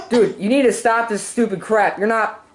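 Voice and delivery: usual speaking voice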